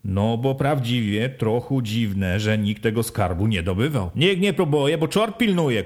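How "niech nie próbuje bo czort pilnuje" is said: a mild shout of superstitious warning. Acted. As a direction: villager shouting a warning from the corner. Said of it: "krzyknął Filip z kąta".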